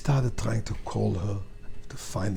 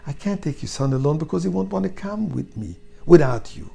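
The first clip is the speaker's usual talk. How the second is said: urgent pleading; 10 semitones higher, 9 dB louder than usual. A narrator's reading. Rabbi urges her to come.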